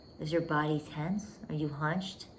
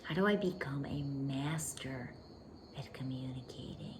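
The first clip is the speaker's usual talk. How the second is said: inner whisper; 3 semitones lower, 6 dB quieter than usual. Retold or imitated. Inner voice wondering how to communicate.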